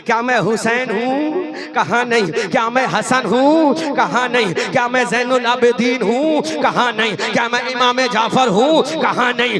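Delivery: rapid shouted litany building to a roar; preaching at full force. Acted. Preacher roaring the litany to the climax. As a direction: pound each question harder.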